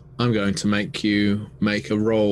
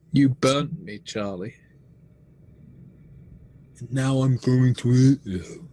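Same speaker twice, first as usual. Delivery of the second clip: higher, louder monstrous threat; menacing creature; 5 semitones higher, 4 dB louder than usual. Acted. The gelatinous mockery threatens to eat Charlie, loud and menacing. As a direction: turn the grief into a hungry threat.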